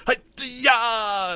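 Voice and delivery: high, drawn-out mock war yell; imitated war cry. Imitating chest-hitting performers for a co-host, mock war cry. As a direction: let out a long high "hi-ya". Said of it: "when people are like".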